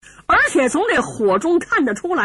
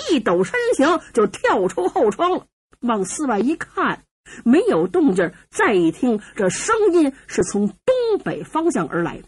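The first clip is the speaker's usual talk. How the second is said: ordinary delivery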